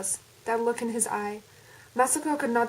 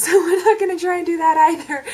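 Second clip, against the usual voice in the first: laughing; 7 semitones higher, 11 dB louder, faster than usual. Real, not acted. Laughing at own accent attempt.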